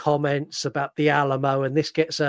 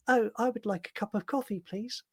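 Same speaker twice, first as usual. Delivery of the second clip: high, soft mock-polite voice; timid polite customer; 6 semitones higher, 5 dB quieter than usual. Outsider ordering at a hostile pub bar, timid and polite. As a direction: tiptoe through the order, high and quiet.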